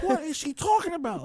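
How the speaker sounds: high, bewildered question; confused teen. Retold or imitated. Teen sister asking about her mom, bewildered and high.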